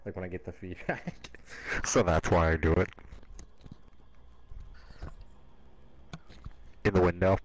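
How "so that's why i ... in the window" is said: muffled radio-like voice through a covered mic; over walkie talkie. Real, not acted. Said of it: "it sounds like I'm talking into a walkie-talkie".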